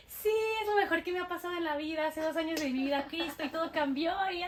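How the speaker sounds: high, fast, breathless-run testimony; imitated overjoyed enthusiasm; gushing convert. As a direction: gush it all out in one stream.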